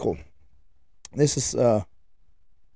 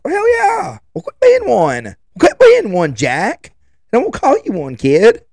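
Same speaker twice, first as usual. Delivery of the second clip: higher, louder yelling back; exasperated dad yell; 9 semitones higher, 5 dB louder, slower than usual. Retold or imitated. Father yelling back at his angry son, exasperated. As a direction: snap back at a sulking kid.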